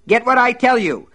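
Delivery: loud high furious shout; furious yell. Acted. Buster screams orders at his wife, loud furious yell. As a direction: bark the order at her. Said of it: "he cried".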